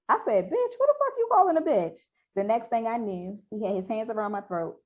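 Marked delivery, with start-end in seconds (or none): 0.5-2.0 very high, loud indignant comeback; outraged retort. Acted. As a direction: throw the insult back, pitched way up and loud. Acting out her furious retort.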